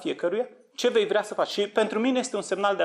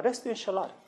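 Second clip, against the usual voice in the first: ordinary talk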